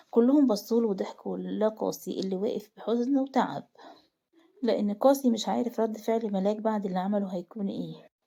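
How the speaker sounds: ordinary delivery, nothing marked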